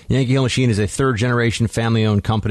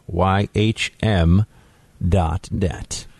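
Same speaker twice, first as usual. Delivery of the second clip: radio voice; much slower than usual. Acted. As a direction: drop into a radio announcer voice. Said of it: "got all radio, radio voice on you".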